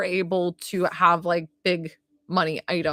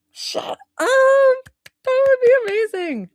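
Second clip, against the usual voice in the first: high, loud delighted squeal; squealing with excitement, much higher and louder; thrilled squeal; 7 dB louder than usual. Real, not acted. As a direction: shriek it like the best news ever. Thrilled reaction to prediction.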